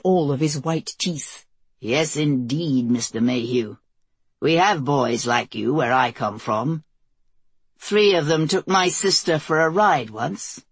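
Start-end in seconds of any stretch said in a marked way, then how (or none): 1.8-10.6 put-on Southern accent. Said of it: "southern twang showing up".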